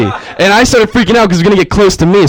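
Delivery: ordinary talk, nothing marked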